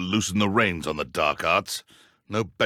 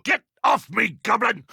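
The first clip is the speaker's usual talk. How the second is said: angry shout at goblin; 11 semitones higher than usual. Acted. Betrayed, grabbed by a goblin.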